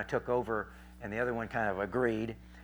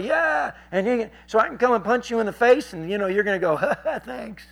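high, loud mocking argument; sarcastic retort; 10 semitones higher, 7 dB louder than usual. Preacher mocks the heckler's logic, loud and sarcastic. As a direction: push it to absurdity, ending in a fake laugh.

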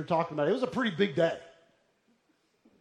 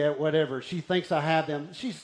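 normal talking voice

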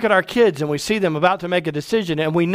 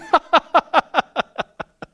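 high, staccato belly laugh trailing away; performed run of ha-ha-has, dying down; hearty mock laugh; 9 semitones higher than usual. Preacher acting God laughing at rebels, hearty mock laugh. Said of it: "he moves from laughter to scoffing".

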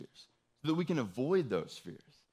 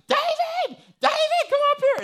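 much higher, louder frantic yell of a name; retold frightened scream; 10 dB louder than usual. Terrified wife calling husband upstairs, mock scream. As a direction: shriek the name like something is attacking. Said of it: "blood-curdling just, just scream".

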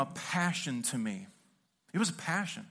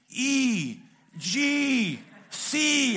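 loud, higher yelled chord names over the band; shouted chord calls; 9 semitones higher, 7 dB louder, much slower than usual. Retold or imitated. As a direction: yell the chords across a loud stage. Singer shouting chords onstage.

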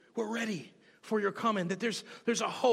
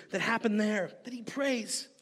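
usual speaking voice

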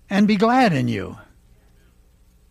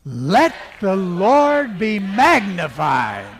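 slow, loud proclamation; real loud; 3 semitones higher, 5 dB louder, much slower than usual. Shown as a demonstration. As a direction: declare it out loud to the room. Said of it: "and then the third time real loud".

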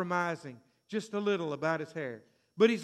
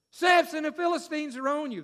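higher, louder alarm cry of Samson's name; alarm shout; 8 semitones higher, 6 dB louder than usual. Retold or imitated. Delilah crying out to Samson, loud alarm. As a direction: shout the warning to wake him.

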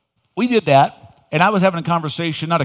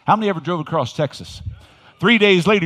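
ordinary talk, nothing marked

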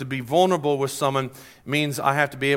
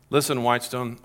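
ordinary delivery

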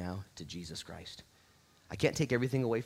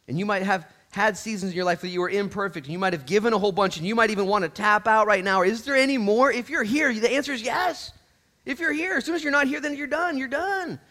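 high, loud, climbing exhortation; impassioned preaching; 14 semitones higher, 12 dB louder than usual. Real, not acted. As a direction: drive it home at full voice.

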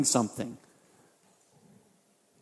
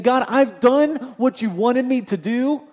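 higher, louder indignant reproach; indignant complaint; 6 semitones higher, 3 dB louder than usual. Acted. Habakkuk reproaching God, higher, louder indignant complaint. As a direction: demand God do his part. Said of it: "Habakkuk could be saying".

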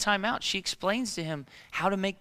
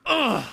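high, loud frustrated "ugh"; exasperated groan; 7 semitones higher, 4 dB louder than usual. Retold or imitated. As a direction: imitate a wife's pent-up groan. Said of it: "she always goes".

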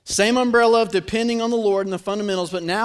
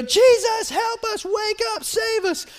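high, strained cry for rescue; panicked plea; 11 semitones higher, slower than usual. Acted. Disciples waking Jesus in the storm, high panicked plea. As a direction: play the panicked disciples, pitched way up.

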